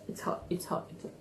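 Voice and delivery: whispered under the breath. Real, not acted.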